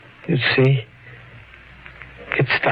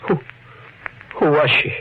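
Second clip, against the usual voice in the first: anxious urgency; 5 semitones higher, faster than usual. Acted. Sam panics about dead woman.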